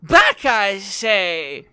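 high, slow, sneering villain voice; sneering wrestling villain. Acted. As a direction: gloat like a cartoon tyrant. Villain orders them back.

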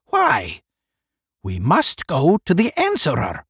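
high-pitched urgent cry. A narrator's reading. One urging the group onward, crying out. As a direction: raise the voice and demand it. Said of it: "one cried".

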